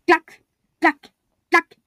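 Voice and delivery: loud, high clack clack clack; clacking steps. A narrator's reading. Narrator voices the mole's stick tapping, loud. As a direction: knock it out loud like a stick on the ground.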